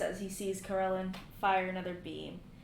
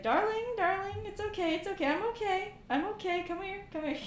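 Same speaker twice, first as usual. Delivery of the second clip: soothing; 12 semitones higher, 5 dB louder than usual. Acted. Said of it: "He's like".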